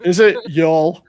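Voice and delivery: mock American drawl on y'all; bad American accent. Teasing friends about the actor's horrible accent, bad American accent. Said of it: "His American accent is horrible".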